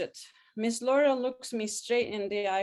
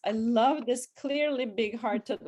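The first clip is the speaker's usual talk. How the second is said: ordinary delivery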